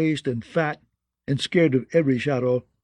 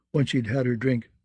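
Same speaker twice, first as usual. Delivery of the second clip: plain reading voice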